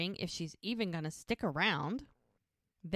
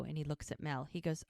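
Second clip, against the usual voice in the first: ordinary talk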